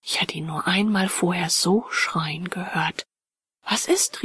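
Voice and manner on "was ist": urgent question, pitched sharply up; startled higher-pitched cry; calling out. A narrator's reading. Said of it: "rief ich, kaum weniger laut".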